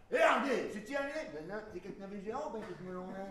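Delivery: put-on high, loud rustic accent; country bumpkin. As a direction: play a yokel gawking.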